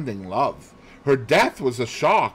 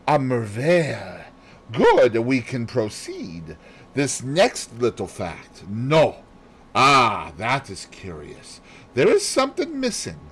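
Poirot voice; 3 semitones higher than usual. Acted. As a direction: build the chain of facts, savouring each step. Poirot explains his method.

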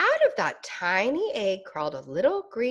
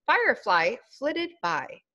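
ordinary delivery

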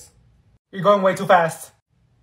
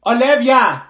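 impatient call; 3 semitones higher, 8 dB louder, slower than usual. Acted. Teacher snaps at hesitating student.

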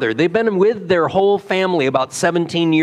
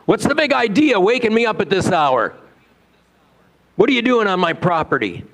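higher, mock-indignant farmer yelling; imagined angry shout; 7 semitones higher than usual. Imagined farmer yelling at the late visitor, mock angry. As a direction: ham up a grumpy farmer woken at night. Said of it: "he'd probably shout something like".

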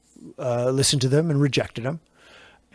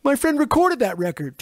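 high-pitched imitation of an excited friend; mock proud call-out; 11 semitones higher than usual. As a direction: call the boast over to the next car. Friend boasting across to next car.

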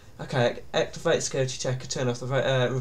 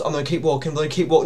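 ordinary talk

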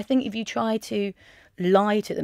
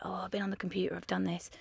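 much softer and lower, almost whispered; hushed stepdad answer; 3 semitones lower, 11 dB quieter, faster than usual. Retold or imitated. Stepdad answering a routine question about his day, hushed. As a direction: imitate a low-key reply under the breath.

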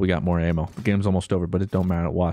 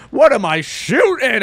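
baffled louder outburst; loud, slow confusion; 11 semitones higher, 7 dB louder than usual. Real, not acted. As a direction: ask it in loud disbelief.

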